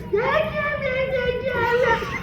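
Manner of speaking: tiny, very high falsetto; comically high-pitched bad example; squeaky silly voice. Shown as a demonstration. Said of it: "you can't say it like this".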